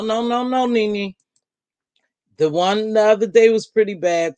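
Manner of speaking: ordinary delivery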